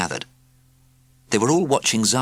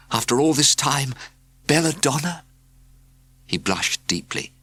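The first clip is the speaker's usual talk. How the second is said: ordinary delivery, nothing marked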